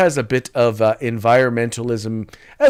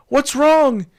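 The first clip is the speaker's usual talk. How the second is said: high, mock-worried question; high mock-concerned question; concerned onlooker; 4 dB louder than usual. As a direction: ask it like a fussy bystander. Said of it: "they say".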